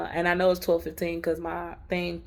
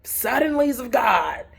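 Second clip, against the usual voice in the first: preacher quote; 7 semitones higher, 6 dB louder, slower than usual. Retold or imitated. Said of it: "And he was like".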